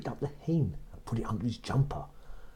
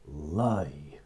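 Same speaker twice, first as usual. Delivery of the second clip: a lower giant's order; giant's command; 6 semitones lower than usual. Acted. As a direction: order the hen to lay.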